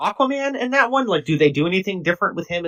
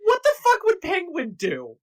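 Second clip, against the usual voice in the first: higher, disbelieving outburst; can't believe the plot; incredulous; 9 semitones higher, 3 dB louder than usual. Real, not acted.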